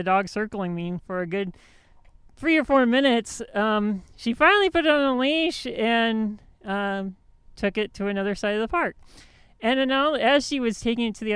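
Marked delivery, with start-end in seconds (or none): none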